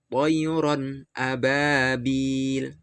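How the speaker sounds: toyron ababil slowly, ron and a kept clearly apart; clear izhar. Shown as a demonstration. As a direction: recite toyron ababil with a clean nun before a. (toyron ababil dibaca jelas, izhar)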